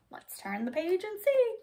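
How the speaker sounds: ordinary delivery, nothing marked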